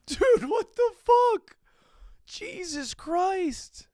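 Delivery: very high, drawn-out wail of disbelief; distressed outcry. Real, not acted. Upset by tearful farewell video.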